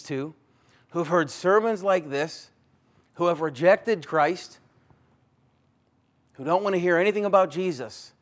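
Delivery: heartfelt urgent call. Real, not acted. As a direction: plead with rising force. Urgent appeal.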